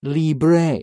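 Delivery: put-on Libre with a Latin accent on the end. Imitating the Italian seatmate, accented Libre. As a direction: roll out Libre with a foreign ending. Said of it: "adds special accent to the end of the word".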